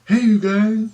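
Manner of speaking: high called-out hey; mock yell. Calling down to the aliens below, a high mock yell. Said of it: "I'll scream really loud".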